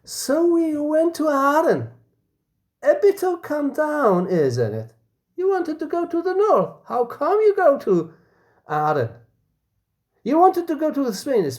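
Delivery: high mocking questions; teasing friend. A F mocking a friend's trip to Aden, high teasing voice. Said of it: "A F says".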